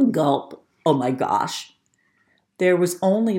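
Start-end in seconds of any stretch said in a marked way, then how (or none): none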